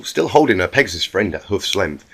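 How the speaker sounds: ordinary delivery, nothing marked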